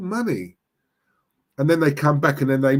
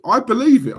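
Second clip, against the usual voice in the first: I believe it, much higher and louder; emphatic; 9 semitones higher, 7 dB louder than usual. Real, not acted. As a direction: hammer home the conviction. Emphatic claim during rant.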